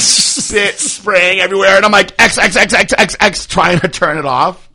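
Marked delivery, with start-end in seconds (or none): 2.2-3.5 high, loud panicked repeating; frantic clicking. Retold or imitated. Frantic host clicks the porn windows shut, panicked and loud. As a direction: hammer each X in a panic.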